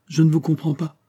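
coolly dismissive, pitched high; a cold, understated rebuff. A narrator's reading. Aramis rebuffing Fouquet's objections, coldly. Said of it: "dit froidement Aramis".

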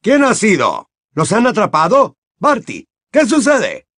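high, loud rattled questions; flustered Bagman. Acted. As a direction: Bagman firing questions at his colleagues.

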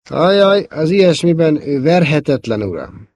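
high, put-on chirpy reply; affected cheerfulness. A narrator's reading. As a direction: chime in with forced good cheer, falling on the last word. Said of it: "kontrázott neki three P O mesterkélt vidámsággal". (mesterkélt vidámsággal)

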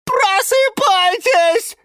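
slow, high, loud wake-up call; alarmed shout. Acted. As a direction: cry out to rouse the whole house. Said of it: "крикнул Лимон Филимон".

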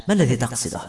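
plain reading voice

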